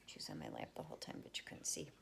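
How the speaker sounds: fast hushed whisper. Real, not acted. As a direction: whisper so the cat stays calm. Cat settled on her lap.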